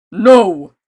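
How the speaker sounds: loud booming no; blunt refusal. A narrator's reading. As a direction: shut her down. Giant refuses flatly.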